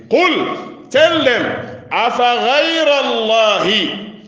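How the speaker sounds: high, ringing recitation with long-held syllables; impassioned preaching. Real, not acted.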